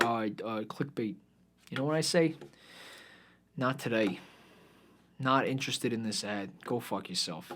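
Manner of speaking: soft, exasperated grumbling; done with it. Real, not acted. Fed-up host scrolling clickbait, soft and exasperated. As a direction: mutter it like you've completely given up. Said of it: "I, I can't".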